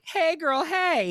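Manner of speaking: high, bright squealed hello; sing-song greeting. Pretend. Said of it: "make you say".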